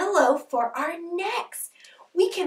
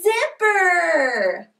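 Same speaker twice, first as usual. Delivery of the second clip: excited yell; 6 semitones higher, 4 dB louder, slower than usual. Shown as a demonstration.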